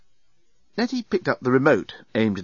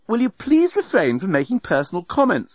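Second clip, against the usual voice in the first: higher, crisply annoyed request; rather cross; 9 semitones higher than usual. A narrator's reading. Passenger to bellbot about personal comments, rather cross. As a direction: rebuke politely but crossly. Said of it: "said Nettie rather crossly".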